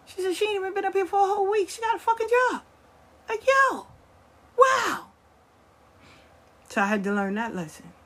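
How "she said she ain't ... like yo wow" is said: very high, loud incredulous neighbor voice; mocking neighbor. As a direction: squeal it out in disbelief. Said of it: "sitting in the projects like".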